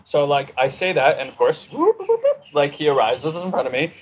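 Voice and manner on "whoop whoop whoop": high-pitched whoop sound effect; quick, high whoops for something popping up; imitated whooping. Ego suddenly arises in front of the speaker, high imitated whoops.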